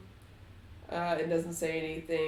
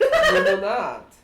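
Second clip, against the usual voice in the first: laughing; 12 dB louder than usual. Acted. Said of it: "And Big Strahd says".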